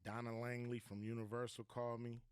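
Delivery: ordinary talk, nothing marked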